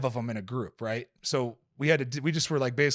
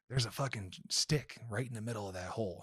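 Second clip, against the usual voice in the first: hushed quote; 10 dB quieter than usual. Retold or imitated.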